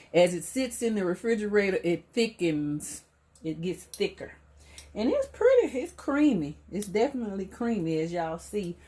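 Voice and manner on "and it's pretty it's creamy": high excited praise; delighted high. Real, not acted. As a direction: gush over the creamy texture. Admiring the ranch.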